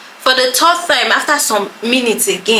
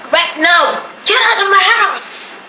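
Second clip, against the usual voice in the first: mock yell; 9 semitones higher than usual. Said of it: "she was like".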